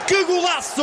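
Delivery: soaring, shouted goal call; explosive goal commentary. Real, not acted. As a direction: erupt as the ball hits the net.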